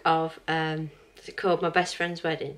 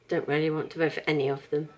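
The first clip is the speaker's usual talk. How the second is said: ordinary talk, nothing marked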